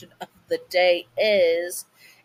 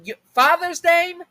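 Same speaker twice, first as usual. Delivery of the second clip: high, loud call across the house; 6 dB louder, slower than usual. Acted. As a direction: call for the husband from another room, much higher. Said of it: "she calls".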